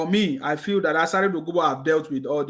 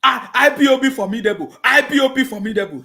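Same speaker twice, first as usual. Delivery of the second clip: louder and higher mock of their slogan; scornful echo; 6 semitones higher, 7 dB louder than usual. Streamer jeering at rivals' slogan, loud mocking echo. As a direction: throw their boast back at them, jeering.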